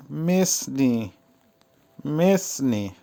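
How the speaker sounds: মেসনি split as মেস-নি; teacher repeats মেসনি clearly; careful pronunciation demo. Shown as a demonstration. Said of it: "উচ্চারণ হবে". (মেসনি-র স্পষ্ট উচ্চারণ)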